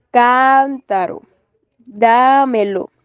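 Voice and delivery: "cántaro, dámelo" with long stressed CÁN and DÁ; "cántaro, dámelo" slowly, weight on CÁN and DÁ; stress on antepenultimate syllable. Shown as a demonstration. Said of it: "El acento está en la antepenúltima sílaba".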